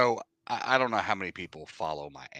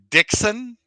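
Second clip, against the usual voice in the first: a hint of a French accent, higher and louder; 13 dB louder than usual. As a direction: lightly mimic a French accent calling the name. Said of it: "with a very French accent".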